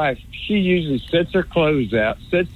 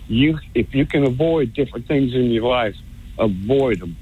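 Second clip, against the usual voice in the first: ordinary talk, nothing marked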